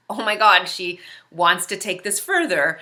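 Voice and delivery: suddenly loud, startled oh my God; alarmed inner gasp. Retold or imitated. Alone in her car, she sees the angry driver coming back, loud alarmed exclaim. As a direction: blurt it out in alarm. Alarmed thought as stranger approaches.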